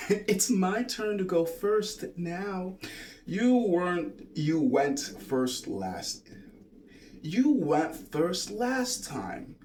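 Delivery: slow, high, sing-song gentle voice. Shown as a demonstration. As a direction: explain it gently, drawing the words out. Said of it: "gentle tone of voice".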